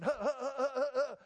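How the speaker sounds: high scoffing huh-huh-huh laugh; mocking laugh. Real, not acted. Pastor scoffing at skeptics' questions with a mocking laugh. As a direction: scoff out loud at the idea.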